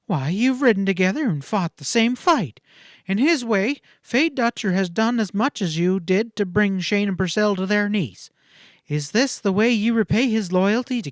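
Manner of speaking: impatient pleading. A narrator's reading. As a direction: press him hard, voice lifted. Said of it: "gesture of impatience".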